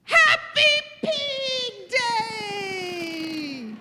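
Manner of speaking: high, loud, long drawn-out cheer; jubilant announcement. Acted. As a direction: proclaim it slowly, stretching every word in glee. Piggy proclaims the happy holiday.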